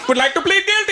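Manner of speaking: put-on high-pitched comic Indian accent; hammed-up Indian-accented lawyer, voice shooting up. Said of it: "pop in the Indian accent".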